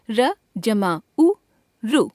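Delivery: slowly, syllable by syllable; slow spelling out. Shown as a demonstration. Teacher spelling out a syllable for pupils, slowly. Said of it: "समझते चलें".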